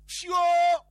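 very loud, high, drawn-out "shio"; long high yell mimicking skidding tyres; imitated brake screech. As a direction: shout out the screech of sudden braking. Imitates the car braking hard.

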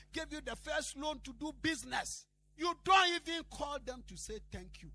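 high, driving list building to a loud rebuke; insistent preaching. Real, not acted. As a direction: pile up each phrase with mounting force.

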